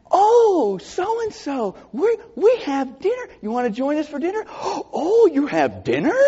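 high falsetto, hammed-up hostess gasp; mock surprised host. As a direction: play the flustered host caught at dinner. Mocks hosts surprised by dinner visitor.

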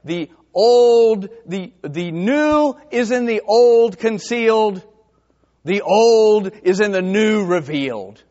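loud, slow ringing proclamation; preacher's saying. Retold or imitated. Quoting a preacher to the congregation, proclaiming. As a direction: declaim it like a pulpit line. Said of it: "One preacher said it this way".

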